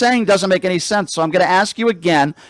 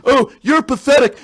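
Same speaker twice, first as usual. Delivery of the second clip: mocking caller; 3 semitones higher than usual.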